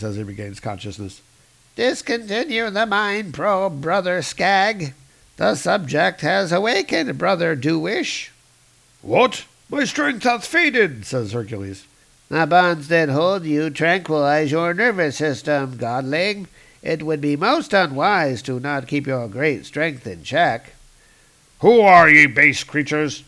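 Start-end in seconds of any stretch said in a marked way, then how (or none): 1.8-8.3 higher, solemn alien monk; alien cultist voice. Acted. Voices alien reading comic. 9.0-11.1 higher, startled archaic hero; a dazed demigod waking up; Hercules voice. Acted. 12.3-20.7 cool, menacing alien; alien cultist voice. Acted. Alien warns Hercules. 21.6-23.2 loud archaic challenge; Hercules shouting. Acted. Hercules demands loudly.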